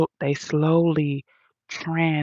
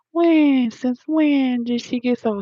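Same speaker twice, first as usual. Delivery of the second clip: mock outraged commenter; 9 semitones higher, 4 dB louder, slower than usual.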